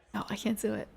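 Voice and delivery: breathy ASMR whisper, close to the mic; soft unvoiced ASMR whisper, giving up. Shown as a demonstration. Giving up on an ASMR whisper to the listeners, soft whisper. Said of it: "I can't do it in the A M S M R, A S M R whispers".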